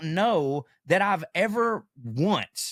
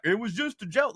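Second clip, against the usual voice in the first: higher-pitched mock innocence; mock excuse; 7 semitones higher than usual. Mimicking a worker excusing a crude remark, mock innocent. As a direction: imitate a feeble excuse, pitched up.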